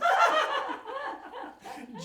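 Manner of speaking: high, bubbly giggle; tickled giggle. Shown as a demonstration. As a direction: laugh as if tickled. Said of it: "tickle yourself and go".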